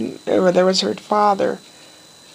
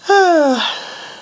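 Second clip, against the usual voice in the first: contented sigh; 8 semitones higher, 3 dB louder than usual. Real, not acted. Happy sigh after "made my day.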